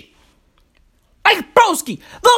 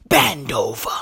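bend over, said much lower; low menacing order; 11 semitones lower, 5 dB quieter than usual. Retold or imitated. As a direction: drop the voice to a threat. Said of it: "went up to fucking Mohamed El Neni and said".

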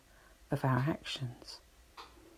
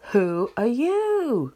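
loud, slow, drawn-out echo; high, stretched-out answering shout; echoed yell; 7 semitones higher, 7 dB louder, slower than usual. A narrator's reading. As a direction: call it back slowly like a mountain echo. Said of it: "He receives the answer".

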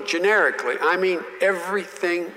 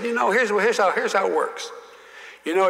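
Russell Long explaining; 6 semitones higher than usual. Retold or imitated. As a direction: talk it up high like an old Southern senator schooling a kid. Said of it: "he said".

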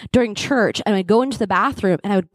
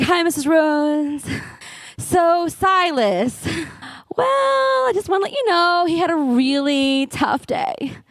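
higher, slow too-sweet teacher voice; teacher breaking bad news; 6 semitones higher, slower than usual. Teacher tells the mother her son's tough day, higher and slow. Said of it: "She's like".

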